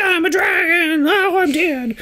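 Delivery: very high, drawn-out silly wail; mock cartoon dragon. Dragon from a cartoon spoof, squealing melodramatically. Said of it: "a dragon would come out and be like".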